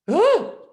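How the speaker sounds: loud, high acted gasp; awestruck gasp. Nurse seeing a light come down, awestruck gasp.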